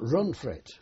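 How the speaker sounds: a soldier yelling Run for it; yelled warning. Retold or imitated. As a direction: shout to the others to flee. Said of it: "someone yells out".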